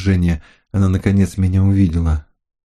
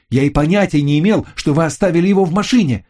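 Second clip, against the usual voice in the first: insistent repeat; 8 semitones higher, faster than usual. Acted. Narrator repeats his denial.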